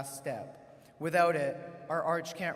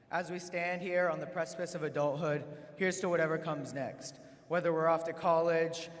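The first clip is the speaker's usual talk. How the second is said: ordinary delivery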